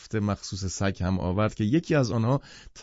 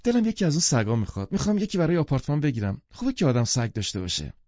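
quick, higher-pitched excited wish; eager enthusiasm; 5 semitones higher, faster than usual. Acted. Mrs Wilson wants a dog for the apartment, eager. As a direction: gush eagerly, words tumbling out fast. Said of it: "با شور و شوق گفت".

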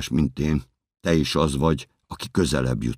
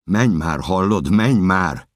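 raised, urgent yell building louder; desperate shout; 5 semitones higher, 6 dB louder, slower than usual. Acted. Narrator urging the woman to flee, desperate shout. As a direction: shout at her to get moving.

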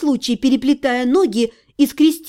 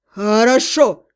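low, drawn-out grudging word; an understated, sulky demon bark; offended demon growl; 3 semitones lower, slower than usual. A narrator's reading. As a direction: drag it out low and slow. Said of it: "обиженно рявкнул демон".

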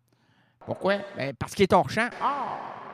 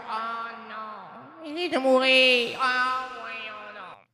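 mock despair; 4 semitones higher, much slower than usual. Mock despair over dying dog.

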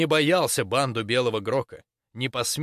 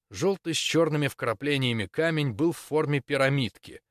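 ordinary delivery

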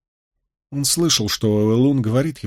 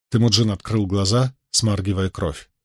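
plain reading voice

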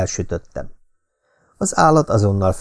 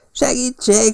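desperate cry for help; slower than usual. A narrator's reading. Someone in the trees shouting desperately for help. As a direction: yell for help as if in danger. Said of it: "kiabált valaki kétségbe esetten".

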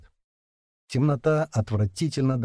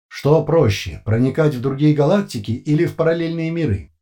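loud, higher emotionless questioning; metallic voice; 5 semitones higher, 6 dB louder than usual. A narrator's reading. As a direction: read it like a questionnaire. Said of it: "владелец металлического голоса".

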